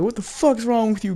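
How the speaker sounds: hammed-up high-pitched outrage; losing her shit. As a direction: imitate an outraged woman way up high. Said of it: "She lost her shit".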